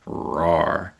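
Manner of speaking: low growled rawr, like a little beast; playful growl. Pretend. Sponsor slogan, playful roar.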